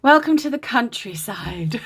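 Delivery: mock West Country twang; bad West Country accent. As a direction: ham up a silly West Country welcome. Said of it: "That's the worst accent ever".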